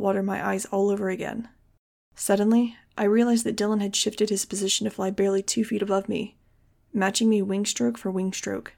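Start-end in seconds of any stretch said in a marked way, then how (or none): none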